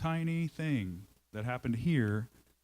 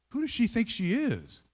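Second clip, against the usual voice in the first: raised, higher indignant outburst; imitating anger; 9 semitones higher, 3 dB louder than usual. Pastor voicing his own resentment, higher and indignant. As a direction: huff it out like someone offended. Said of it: "Now I'm mad".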